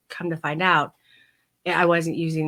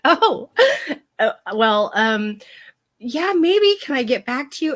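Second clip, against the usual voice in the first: high, loud oh, then hesitant flirting; coy, flustered; 7 semitones higher, 5 dB louder than usual. Retold or imitated. Client puts off vet's date offer, coy and flustered. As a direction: imitate flustered coyness, pitching up. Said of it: "how coy and flirtatious I was being".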